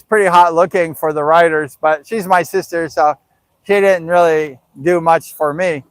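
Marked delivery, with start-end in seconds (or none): none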